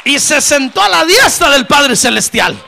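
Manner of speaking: high, full-force shout of victory; roaring pulpit climax; triumphant preaching shout. Real, not acted. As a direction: proclaim it at the top of your voice. Triumphant climax of the resurrection story.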